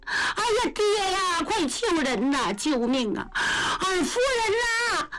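high, wailing cries for help, slowing on the last call; a desperate girl sobbing out to her father for rescue; crying for help. Acted. Girl shrieks to her father for help, crying.

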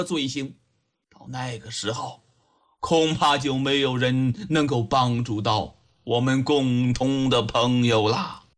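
put-on low, measured elderly counsel; softened tone. A narrator's reading. Old judge reasons with the young woman about their friend, gently. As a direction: ease down into patient, deep-pitched persuasion. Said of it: "把口气放缓了".

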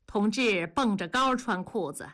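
ordinary delivery

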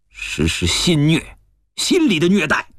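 climbing high in pitch with indignation; indignant outburst. Acted. Man exposing the schemer's mental cruelty, indignant outburst. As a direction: drive the accusation upward, heatedly.

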